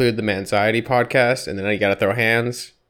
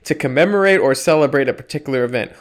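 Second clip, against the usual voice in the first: dictionary read; 6 semitones higher, 6 dB louder than usual. Acted. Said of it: "have me read definitions".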